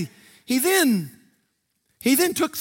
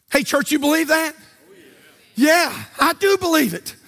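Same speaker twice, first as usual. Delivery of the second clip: higher-pitched, driving sermon delivery; preaching at full force; 4 semitones higher than usual. Real, not acted. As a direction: preach it with urgent exhortation, phrase after phrase.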